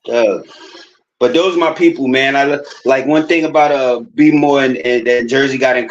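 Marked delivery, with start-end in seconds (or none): 0.0-0.5 "Doug" said hard, dog in a Baltimore way. Retold or imitated. Imitating how Baltimore folks say dog as "Doug". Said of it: "They used to say it hard, bro".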